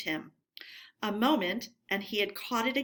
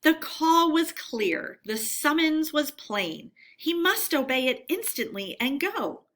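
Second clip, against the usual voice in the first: ordinary delivery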